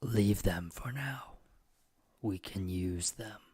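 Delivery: slow, whispered demon voice; calm and sinister, like a voice in his head. Acted. Demon whispering to the player about the party. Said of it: "the demon goes".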